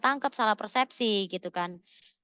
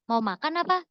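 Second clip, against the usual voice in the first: "Mau makan apa" said higher and short; second-meaning question; 5 semitones higher than usual. Shown as a demonstration. Repeating the confusing chat message, higher offer question. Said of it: "Bisa jadi kayak gitu".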